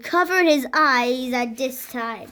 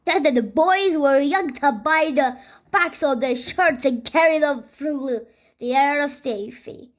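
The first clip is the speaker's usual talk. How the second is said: ordinary delivery, nothing marked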